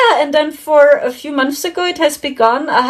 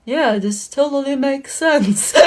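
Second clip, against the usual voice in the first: usual speaking voice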